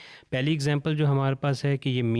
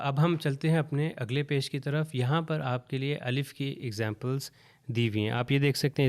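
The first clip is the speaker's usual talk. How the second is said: usual speaking voice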